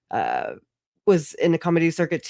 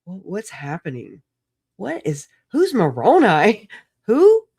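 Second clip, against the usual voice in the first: rising to a high, loud baffled squeak on who; confused surprise; 4 semitones higher, 5 dB louder than usual. Retold or imitated. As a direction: climb from lost to totally baffled. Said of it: "I was like".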